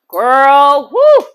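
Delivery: loud drawn-out whoop, shooting up high; amazed exclamation. Real, not acted. Host reacting to the messy drama, loudly amazed. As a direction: let out a big high-pitched whoo.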